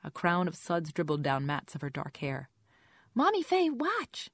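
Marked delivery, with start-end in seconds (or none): 3.1-4.3 put-on very high little-girl call; excited toddler. A narrator's reading. Toddler in the tub calls to her mommy, excited child voice. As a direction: call out like a small child wanting an audience.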